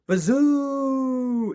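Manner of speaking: high, loud ghostly wind howl; eerie wind whoosh. A narrator's reading. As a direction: blow it like a storm wind through the chimney. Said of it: "the wind started blowing".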